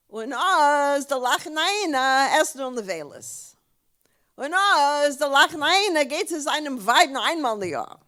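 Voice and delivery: loud, insistent list of questions; father demanding answers in Yiddish; Yiddish-speaking father. Acted. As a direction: put each question emphatically, leaning on the opening "Oh". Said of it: "yeah, in Yiddish".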